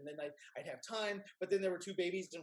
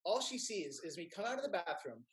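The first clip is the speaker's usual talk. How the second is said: normal talking voice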